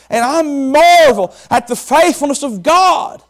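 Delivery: high, loud, rising preacher's cry; full-force preaching. Acted. Preacher to the congregation, high and loud full-force preaching.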